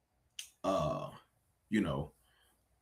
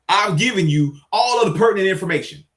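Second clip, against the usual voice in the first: ordinary talk, nothing marked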